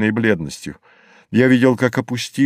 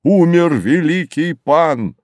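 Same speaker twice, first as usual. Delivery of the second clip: high, slow ringing proclamation; raised voice, stretched solemn call; 6 semitones higher, 4 dB louder than usual. A narrator's reading. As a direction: cry it out across the water, drawn out. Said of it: "он воззвал".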